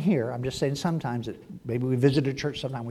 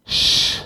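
breathy shush; hushing shh; 5 dB louder than usual. Retold or imitated. God hushing Peter with a shush. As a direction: hush him sharply. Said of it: "and then the Lord".

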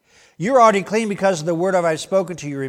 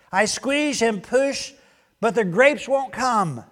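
slow, higher straining voice; strained branch; 5 semitones higher, slower than usual. Acted. Tendril complaining to the farmer, slow strained lightly voiced. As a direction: squeeze it out like a weary vine.

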